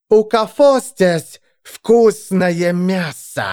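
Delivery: high, slow, hungry hiss; monstrous hiss. A narrator's reading. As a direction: hiss it slowly through growing fangs. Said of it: "прошипела Вигга".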